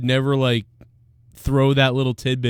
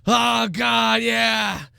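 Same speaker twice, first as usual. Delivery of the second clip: masturbating man; 10 semitones higher, slower than usual. Retold or imitated. Pretending to be the man in his car, high moaning shouts. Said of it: "he's like".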